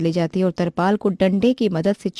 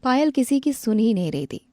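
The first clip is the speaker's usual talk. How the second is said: ordinary delivery, nothing marked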